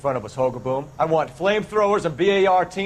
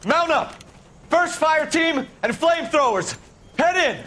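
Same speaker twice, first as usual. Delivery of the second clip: shouting orders; 11 semitones higher, 11 dB louder than usual. Acted. Officer ordering troops into battle.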